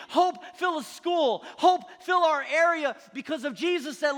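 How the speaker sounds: high, full-force preaching shout; rousing preaching. Real, not acted. Preacher urging the church to rise up, rousing shout. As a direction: build a shouted rallying cry, phrase on phrase.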